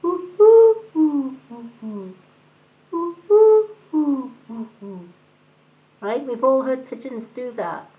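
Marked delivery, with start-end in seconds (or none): 0.0-2.1 pigeon cooing imitation. Shown as a demonstration. To her audience, showing a pigeon's call: high hooting coo. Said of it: "a pigeon's call will go". 2.9-5.0 pigeon cooing imitation. Shown as a demonstration. To her audience, showing a pigeon's call: high hooting coo. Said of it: "a pigeon's call will go".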